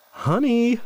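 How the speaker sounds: cheerful call home; cried out. A narrator's reading. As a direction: call out gladly across the room. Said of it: "he cried".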